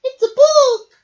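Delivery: very loud high joyful shout; excited cheer. Acted. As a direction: shout the discovery to everyone. Mouse cheers at the find.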